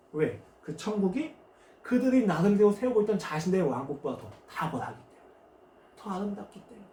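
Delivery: ordinary talk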